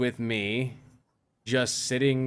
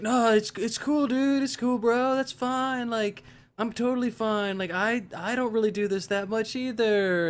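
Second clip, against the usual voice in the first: high, put-on reassuring voice, slowed down; a mocking impression of an overly careful drunk bro; mock overly cautious partier; 11 semitones higher, slower than usual. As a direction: imitate a partier tiptoeing around him. Mocking people overly cautious with him.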